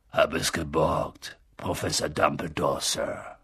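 low, rough giant of a man, dutiful; Hagrid's voice. A narrator's reading.

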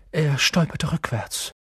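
usual speaking voice

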